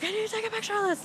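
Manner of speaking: high, rushed and eager, fast; quick, high, eager gush; excited. Retold or imitated. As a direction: blurt it out in a rush. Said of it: "He was like".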